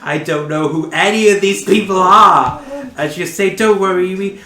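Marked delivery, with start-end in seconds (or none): 0.0-2.6 high, loud bewildered protest; hammed-up confused outburst; baffled Flint. 3.6-4.4 smother him with kindness; mock sweet insistence; pushy reassurance. Sophie reassures Flint.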